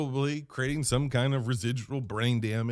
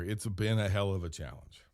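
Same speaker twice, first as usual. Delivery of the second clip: normal talking voice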